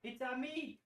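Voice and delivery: put-on a squeaky, high Italian-accent catchphrase; high hammed-up Italian accent; Mario Italian accent. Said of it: "the Italian accent the whole time".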